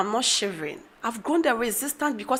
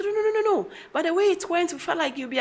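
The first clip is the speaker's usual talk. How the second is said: lightly voiced child, higher; a hint of a child, pitched up; children's voice; 8 semitones higher, much faster than usual. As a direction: imitate kids hurriedly denying it. Mother voices children reassuring her.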